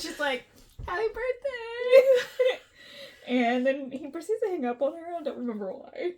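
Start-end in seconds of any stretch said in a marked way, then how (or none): 0.9-2.6 high, very drawn-out, louder; mock awkward greeting. Ex-girlfriend wishing him happy birthday, mock awkward. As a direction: stretch it out squeakily, close to laughing.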